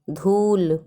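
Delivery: धूल with a drawn-out oo; long u. Shown as a demonstration. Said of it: "बड़ा ऊ की मात्रा".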